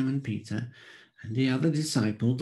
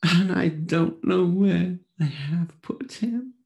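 grieving Mary; 6 semitones higher, 5 dB louder than usual. A narrator's reading. Mary answers the angels.

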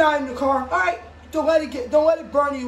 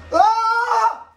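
startled scream; 5 semitones higher, 5 dB louder than usual. Pretend. Car attack in pretend play.

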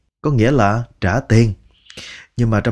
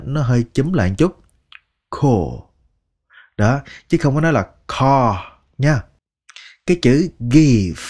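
usual speaking voice

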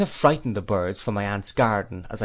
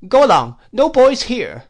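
much higher and louder, a put-on old lady's voice; stern old aunt; 13 semitones higher, 10 dB louder than usual. A narrator's reading. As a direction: shoo him off the garden like a fierce old spinster. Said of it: "said Miss Betsy, shaking her head".

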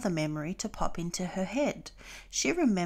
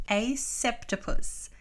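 amused repeat; 3 semitones higher, slower than usual. A narrator's reading. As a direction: narrator repeating the word septopus with a grin. Narrator repeats aloud, amused.

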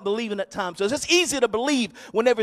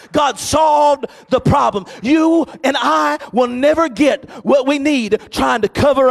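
loud, raised preacher's delivery; full-force preaching; 3 semitones higher, 8 dB louder than usual. Real, not acted. Pastor telling the church to hand problems to God, full-force preaching. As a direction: punch the phrases out to the whole church.

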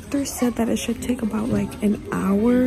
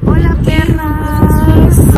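loud greeting; 3 semitones higher, 15 dB louder, slower than usual. Real, not acted. Loud playful greeting to camera.

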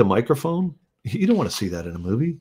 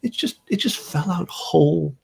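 much higher, airy voice, brushing it off; mock innocent songwriter; 11 semitones higher than usual. Songwriter voicing a great writer's breezy denial, much higher. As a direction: play the songwriter pretending it took no effort. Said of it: "make that stuff just drift by you".

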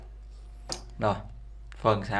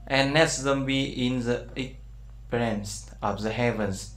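"And let them be in the expanse of the heavens" read very slowly, softer; slow verse reading; 4 dB quieter, much slower than usual. Shown as a demonstration. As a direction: read the verse out carefully for the class. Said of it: "Đây nó sẽ gì?". (giáo viên đọc chậm câu Kinh Thánh cho học sinh)

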